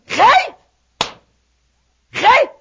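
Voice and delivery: a lightly mocking, high, rising "خايف". Preacher mocking the congregation's excuse, high and rising. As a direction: toss the word back as if it were silly.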